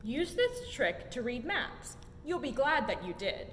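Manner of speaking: plain reading voice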